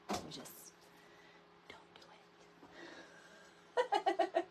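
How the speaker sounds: whispered, breath only. Real, not acted. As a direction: drop to a whisper. Drops to a whisper.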